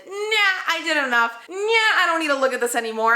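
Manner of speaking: high, loud, bratty brush-off; hammed-up "can't be bothered" voice; mock dismissive. Acting out past self refusing.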